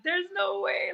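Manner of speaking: high, amazed there's no way; disbelief. Retold or imitated. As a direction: marvel at it, hardly believing. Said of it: "I was just like".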